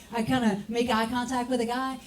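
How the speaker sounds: usual speaking voice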